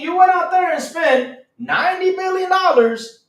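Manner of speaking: loud, high, exasperated ranting at Xbox; venting loudly in disbelief. Real, not acted. Streamer ranting at Xbox over its spending, loud and exasperated.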